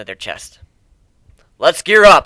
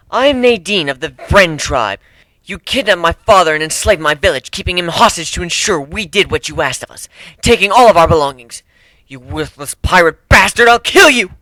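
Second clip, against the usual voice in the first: louder and louder, ending in a furious yell; angry accusation; 2 semitones higher, 6 dB louder than usual. Acted. Captive warrior accuses pirate.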